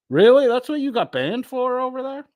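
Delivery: high, loud burst of disbelief; incredulous. Real, not acted. Streamer reacting to a viewer's ban, incredulous. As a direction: squeal the question in disbelief.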